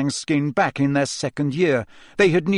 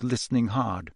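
usual speaking voice

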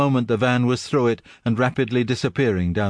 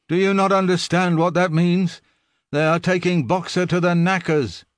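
high, urgent dismay; alarmed; 7 semitones higher than usual. A narrator's reading. Benjamin urging the animals in alarm. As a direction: make them grasp the horror.

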